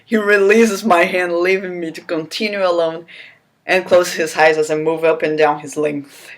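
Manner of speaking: normal talking voice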